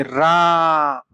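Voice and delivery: रा with the mouth wide open, vowel held long. Shown as a demonstration. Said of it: "मुंह फाड़ो, पूरा मुंह खोलो". (मुंह खोलकर लंबा रा)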